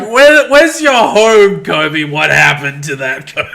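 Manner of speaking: mocking imitation. Player mimicking the questions to Kobe, mocking. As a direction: mimic a tactless questioner, stretched and pushed up. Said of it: "And then you're like".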